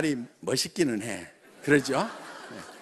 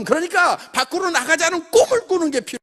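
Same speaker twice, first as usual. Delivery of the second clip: loud and high, a rousing sermon-style pitch; rally the room like a revival preacher; preaching at full force to the crowd; fired-up preaching; 8 semitones higher, 6 dB louder than usual. Acted. Motivational speech, rising to a peak.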